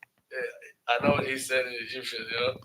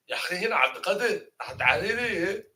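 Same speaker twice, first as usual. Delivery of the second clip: quick, raised Moroccan dialect; Moroccan Arabic; 6 semitones higher, faster than usual. Shown as a demonstration. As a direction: mimic Moroccans rattling off names. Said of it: "Like that, you know?".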